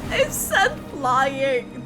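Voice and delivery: ordinary delivery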